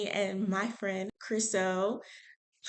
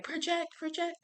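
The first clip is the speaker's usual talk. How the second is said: higher, softer imitation of a coach's prompt; Moses; 8 semitones higher, 5 dB quieter than usual. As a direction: lightly imitate someone urging projection, pitched up. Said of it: "when Moses was like".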